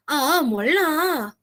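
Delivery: "Ah, molá" with both vowels stretched long; slow, drawn-out lilt on "Ah, molá"; Korean drama intonation. Retold or imitated. Teacher imitating expressive Korean drama talk, stretched intonation. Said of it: "eles sempre fazem essa entonação".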